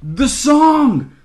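high, loud cry of realization; exclaiming. A narrator's reading. Davery suddenly remembers the song, loud exclamation. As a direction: burst out in alarm, drawn out. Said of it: "when Davery exclaims".

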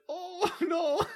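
put-on high, laughing old man yelp. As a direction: squeak it like a startled old man. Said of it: "and he's like".

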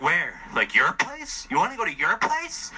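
rising, incredulous asking about the place; surprised question. Retold or imitated. Date surprised at invitation.

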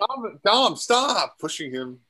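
high then slow pleading voice; mock plea. A light imitation of a sister telling Dom to stop. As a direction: beg your brother to back off. Voicing a character in the storyline.

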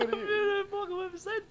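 laughing. Real, not acted. Laughing while speaking.

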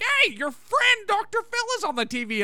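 high-pitched mock excited call; excited Martin imitation. Martin tells Frasier his friend is on TV, imitated excitedly.

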